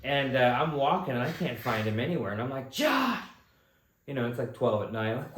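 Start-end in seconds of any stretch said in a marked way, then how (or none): 2.7-3.2 high yelled call across the dark. Retold or imitated. Friend calling into the night for missing Josh. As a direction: shout his name to find him.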